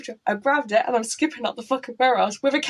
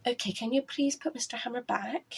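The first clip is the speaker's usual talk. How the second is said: soft careful whisper; whispered coaxing request; hushed plea; 8 dB quieter than usual. Retold or imitated. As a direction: whisper it gently like calming someone. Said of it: "And Sarah went".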